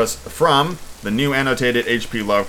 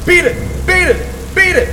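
high, loud shriek of horror; horrified shriek; 5 dB louder, slower than usual. A narrator's reading. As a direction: scream it out in terror. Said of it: "rose into a shriek fraught with all the horror".

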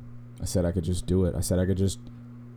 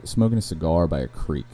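normal talking voice

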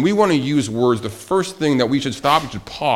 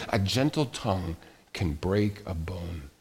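hushed, lowered proverb reading; gentle near-whisper; 6 semitones lower, 9 dB quieter than usual. Shown as a demonstration. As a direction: drop almost to a whisper, tenderly. Quotes proverb on gentle tongue, softly.

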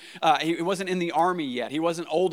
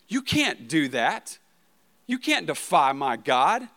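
indignant; 3 dB louder, slower than usual. Retold or imitated. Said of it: "frustrated and angry".

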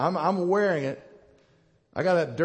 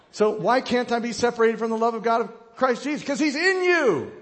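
high, loud preaching rising to a shout; impassioned preaching; 6 semitones higher, 5 dB louder than usual. Real, not acted. Preacher answering why God's love cannot leave, building to a shout.

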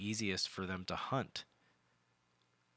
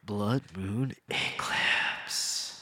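very slow, drawn-out doom voice; gloomy goth deadpan; 4 dB louder, much slower than usual. Shown as a demonstration. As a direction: intone it like a goth band name. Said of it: "There you go. That's better".

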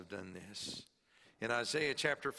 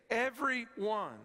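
"Every one" high and punched; stress on every one; 10 semitones higher, 4 dB louder than usual. Shown as a demonstration. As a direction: lean hard on "Every". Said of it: "There's the emphasis again".

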